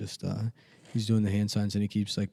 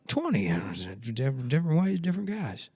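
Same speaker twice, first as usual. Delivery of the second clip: much higher, rambling mumble that wanders off; mumbling old politician; 9 semitones higher than usual. Retold or imitated. Biden asked who he's endorsing, mock mumbling. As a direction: imitate a confused old man losing his thread. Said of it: "He's just mumbling".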